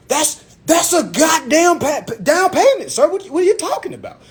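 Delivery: hammed-up exasperated objection; indignant outburst. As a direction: argue back in mock outrage, voice climbing. Acting out his argument with salesman.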